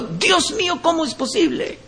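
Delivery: a hushed, stunned reaction; mock dismayed exclamation. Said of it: "responden así, diciendo". (asombro imitado, más agudo y suave)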